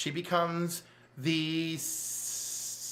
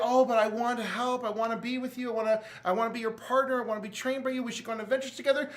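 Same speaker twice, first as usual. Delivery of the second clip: eager Jason; 7 semitones higher, faster than usual. Retold or imitated. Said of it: "and he's like".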